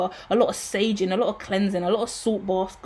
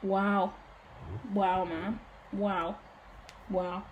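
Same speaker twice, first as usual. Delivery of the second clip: softer, fading wows, lost for words; awed hush; 11 dB quieter, much slower than usual. Real, not acted. Tarot reader stunned by her own reading, softer awed wows. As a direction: let each wow sink quieter, stunned.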